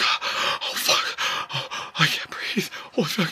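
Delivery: gasping, breathless high whisper; mock panic. Pretending to have a heart attack, panicked gasping. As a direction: act out fighting for air between words. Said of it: "like, panicking and shit".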